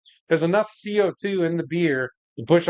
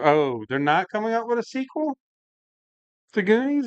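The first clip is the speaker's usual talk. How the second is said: surprised; 6 semitones higher than usual. Real, not acted. Reacting to chat news.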